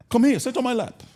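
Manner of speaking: high, loud imitation of Dad's accent; father's accent. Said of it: "that, that accent is fly".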